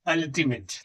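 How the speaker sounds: "अल्टीमेट" corrected to ul-ti-met; short a vowel. Shown as a demonstration. Teacher correcting the reading, "अल्टीमेट" begun with a short uh. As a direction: begin "अल्टीमेट" on a plain short uh, no y. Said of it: "यू नहीं, अल्टीमेट नहीं".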